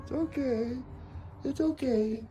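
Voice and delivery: high-pitched reassurance; calm an animal in a high sweet voice; sing-song comforting of a pet; soothing pet talk. Real, not acted. Soothing pets as they meet.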